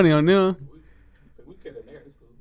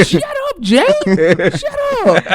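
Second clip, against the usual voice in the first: high squeaky mock voice through laughter; fake neighbor voice. Friend mocking his fake neighbor talk, high and laughing. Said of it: "I hear him say".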